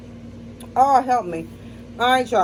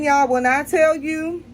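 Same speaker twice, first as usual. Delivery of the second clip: normal talking voice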